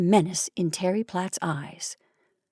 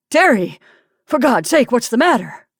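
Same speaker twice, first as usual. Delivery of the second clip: startled, louder and higher call; husband startled by his wife's shriek, calling out in alarm; alarmed shout; 4 semitones higher, 5 dB louder than usual. Acted.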